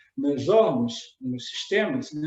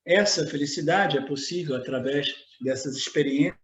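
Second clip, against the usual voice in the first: normal talking voice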